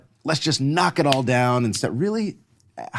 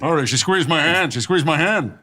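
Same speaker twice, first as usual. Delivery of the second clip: Ted voice; 4 semitones higher than usual. Pretend. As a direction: gush it like a smitten anchorman. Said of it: "you be, uh, Ted".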